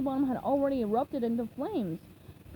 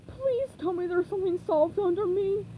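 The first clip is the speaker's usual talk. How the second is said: higher, trembling frightened plea; scared plea; 7 semitones higher, 3 dB louder than usual. A narrator's reading. A clinging customer pleads to SpongeBob and Plankton, frightened plea. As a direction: beg while hanging on for dear life.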